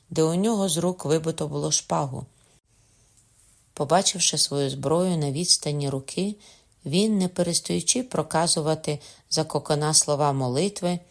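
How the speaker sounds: ordinary delivery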